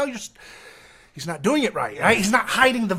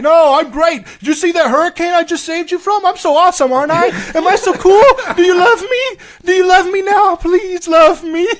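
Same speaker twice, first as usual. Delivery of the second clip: higher and much louder needy braggart voice; mocking Trump; 12 semitones higher, 12 dB louder than usual. Mocking Trump as a needy show-off. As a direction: ham up a boastful leader begging for love. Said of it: "And he's like".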